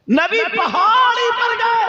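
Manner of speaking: high, loud wailing sermon voice with slow stretched lines; full-force preaching. Acted. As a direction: cry the story out to the crowd at full force. (ऊँची, ज़ोरदार तक़रीर की आवाज़)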